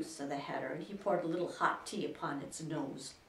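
ordinary delivery

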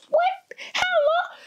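squeaks up high on how long; stunned yelp of a question; shocked disbelief. Retold or imitated. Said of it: "I'm like".

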